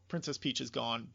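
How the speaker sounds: usual speaking voice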